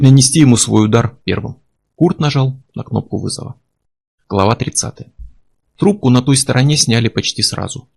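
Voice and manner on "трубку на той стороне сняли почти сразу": usual speaking voice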